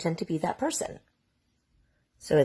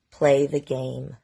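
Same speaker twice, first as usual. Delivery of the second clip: menacing order; 5 semitones lower, slower than usual. Retold or imitated. Abuser's coded order to Haley.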